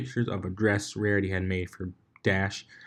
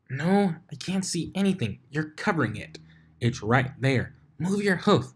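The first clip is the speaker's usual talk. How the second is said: higher, impatient back-and-forth; bickering pony voices; 7 semitones higher than usual. Acted. Two ponies squabbling over a bruise. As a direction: argue over the lump.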